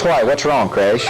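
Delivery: usual speaking voice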